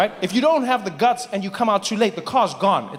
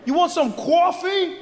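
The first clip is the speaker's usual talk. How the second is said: high, slow, stretched New Yorker yelling; New York accent shout; 9 semitones higher, much slower than usual. Retold or imitated. Comic mocking a New Yorker yelling at a taxi, in a high stretched shout. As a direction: ham up a brash New Yorker yelling at traffic.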